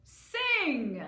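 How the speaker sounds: loud, high, drawn-out command; giant's yell. Acted. Giant ordering his harp in a children's story, yelling. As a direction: yell the order in one long blast. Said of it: "Then the giant yelled".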